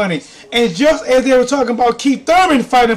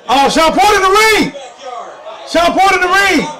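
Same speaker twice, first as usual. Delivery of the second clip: excited shout; 7 semitones higher than usual. Real, not acted. Hyping the live viewers, shouting that Porter is in the ring. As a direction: yell it out over the crowd. Ringside chaos, calling out.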